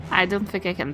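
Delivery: ordinary talk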